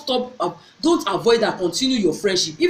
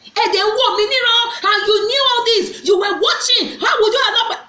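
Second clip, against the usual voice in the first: high-pitched, loud scolding shout; sister scolding; 12 semitones higher, 3 dB louder than usual. Retold or imitated. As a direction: scold her sharply, voice pitched way up. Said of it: "My sister did like this".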